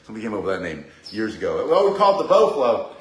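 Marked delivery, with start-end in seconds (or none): 1.7-2.9 higher, louder scoffing at "bow flow"; mock scoff. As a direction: sneer at "bow flow" as a silly new name. Mocking the trendy name.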